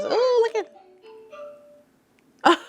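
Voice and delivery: high delighted ooh; excited. Real, not acted. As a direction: squeal with delight. Excited at the toy.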